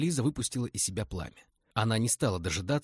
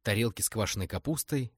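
ordinary delivery

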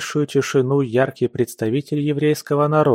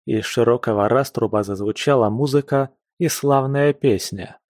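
plain reading voice

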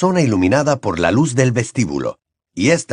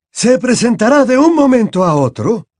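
a raised, courteous headmaster; Dumbledore voiced; 12 semitones higher, 5 dB louder than usual.

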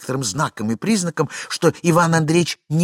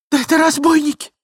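очень высокий голос дамы; вскрикни высоко, в ужасе; испуганный вскрик; 14 semitones higher, 4 dB louder than usual. A narrator's reading. Дама в испуге. (перепуганная дама)

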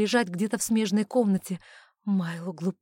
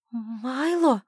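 raised, drawn-out call of a name; effortful, plaintive call; forced out with difficulty; 4 semitones higher, 3 dB louder than usual. Acted. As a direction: squeeze the name out through unwilling lips. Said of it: "выдавили непослушные губы".

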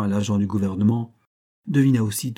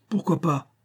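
high, dry retort; curt tone; 7 semitones higher than usual. A narrator's reading. Charles answers a doubting onlooker in a dry, curt tone. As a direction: toss back a curt challenge. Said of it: "demanda Charles d'un ton sec".

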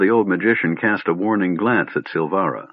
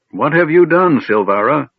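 raised, loud stern demand; 8 semitones higher, 5 dB louder than usual. A narrator's reading. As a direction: demand an answer from the wrongdoer. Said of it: "Fizban demanded".

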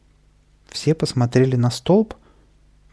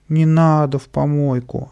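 whining; 5 semitones higher, 4 dB louder than usual. A narrator's reading. Boy begging not to go in the bin, whining. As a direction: whimper like a pleading little boy. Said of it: "захныкал Каприска".

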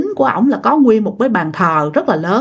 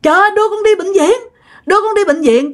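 high, quick faked plea to go to hospital; pretend pleading; 8 semitones higher than usual. Mother faking labor pains, begging grandma, high and hurried. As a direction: beg like you can't bear it, but it's a put-on. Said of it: "mẹ mới nói rằng".

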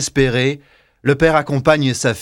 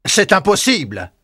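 put-on higher, sharp old man's cry; exclaiming father; 6 semitones higher than usual. Father stopping his sons, higher exclamation. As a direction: cry out in alarm. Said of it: "s'exclame le père".

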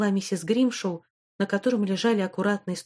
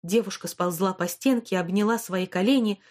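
ordinary delivery, nothing marked

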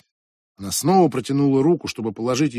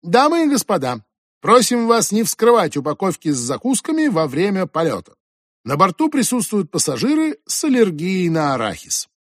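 flight attendant voice; 6 semitones higher than usual. Acted. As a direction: announce it like a flight attendant on board. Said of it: "голосом стюардессы произнесла".

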